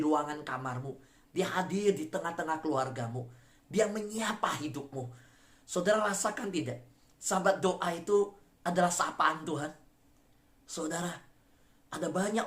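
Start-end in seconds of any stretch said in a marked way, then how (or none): none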